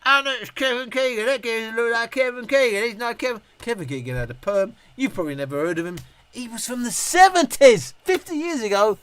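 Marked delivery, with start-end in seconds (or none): 0.0-3.4 mimicked passerby. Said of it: "I hear an echo of". 6.3-9.0 louder and higher, incredulous; loud disbelieving aside; exasperated emphasis. Real, not acted. Exasperated explaining.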